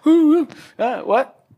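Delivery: high, caught-off-guard yelp; startled. Retold or imitated. Librarian startled when offered prayer, high yelp. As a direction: jump up in pitch, taken aback.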